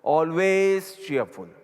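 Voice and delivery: each word drawn out for note-taking; slow dictation. Shown as a demonstration. Guru dictating the English phrase, very slow. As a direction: dictate it slowly to the class. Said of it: "ઇંગ્લિશમાં લખો".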